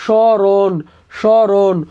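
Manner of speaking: স্মরণ drawn out as "shõ-ron", the m silent; standard pronunciation. Shown as a demonstration. Teacher demonstrating the exam answer, স্মরণ slowly with silent m. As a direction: sound out স্মরণ slowly, nasal vowel replacing the m. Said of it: "উচ্চারণটা কি হবে?".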